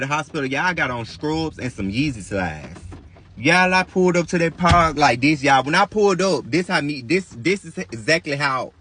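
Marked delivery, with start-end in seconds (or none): none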